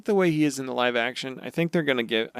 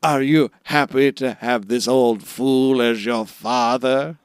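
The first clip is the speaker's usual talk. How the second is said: slow, grave put-on voice, building louder at the final question; Whitebeard; 4 dB louder, slower than usual. Whitebeard asks his son one last thing, emotional imitation. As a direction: imitate a solemn old father, drawn out. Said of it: "has this very emotional moment".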